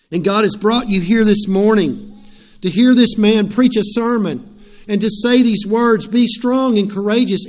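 rally the congregation with full pulpit force; preaching. Acted. Preacher urging the congregation to be courageous, ringing preaching voice.

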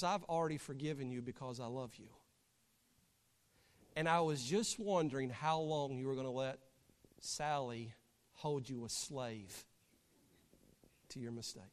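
hushed and tender, sinking to very quiet; tender forgiveness. Acted. Grandma forgiving Joey for killing her duck, tenderly.

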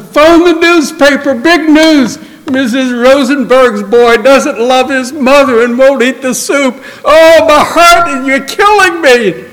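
very high, melodramatic cry to the husband. Acted. Missus Rosenberg calling to her husband about her son, melodramatic high wail. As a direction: ham up a heartbroken mother's despair, slowing on my heart. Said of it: "Calls out to her husband".

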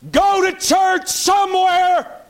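a high, loud, drawn-out cry of go to church somewhere; shouted rebuke. Real, not acted. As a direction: roar it out, stretching every word.

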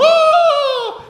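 a sudden high yelp; startled yelp. Retold or imitated. Man startling ladies with a sudden high yelp. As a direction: jump out with a sharp high cry.

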